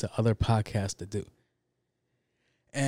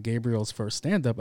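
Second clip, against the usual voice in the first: ordinary talk, nothing marked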